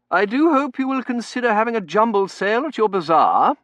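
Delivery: high, loud society matron; gushing committee lady's voice; effusive Mrs Meering. Acted. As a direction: urge it brightly at full projection.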